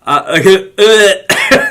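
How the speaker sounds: loud, high groan of disgust with a laugh; disgusted ugh. Retold or imitated. Fed up with New Year's resolution talk, loud disgusted ugh. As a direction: groan like the idea makes you sick. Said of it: "I'm like".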